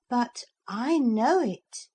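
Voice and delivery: put-on proud boy revealing a secret. Philip claims the magic word, slow and proud.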